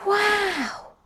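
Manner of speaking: high, far-lifted gasp of wonder; a wide-eyed wow for the kids; amazed wow. Acted. Child wonder at undersea scene.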